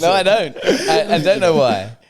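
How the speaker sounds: loud, high protest through laughter; laughing denial. Real, not acted. Friend denying he knows the reason, laughing loudly. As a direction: deny it while cracking up.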